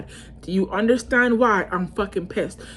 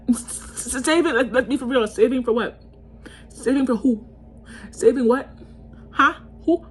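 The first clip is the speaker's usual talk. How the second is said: outraged disbelief; 6 semitones higher than usual. Real, not acted. Ranting to viewers about boyfriend's excuse, outraged. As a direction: hammer the question like you can't believe it.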